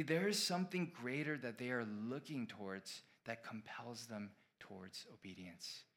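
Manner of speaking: normal talking voice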